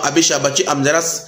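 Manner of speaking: usual speaking voice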